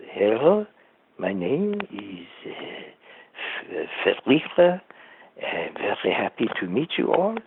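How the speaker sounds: put-on a light French accent on a shy introduction. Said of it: "Who had the accent, they could talk like this".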